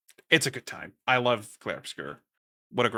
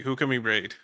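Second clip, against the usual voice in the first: normal talking voice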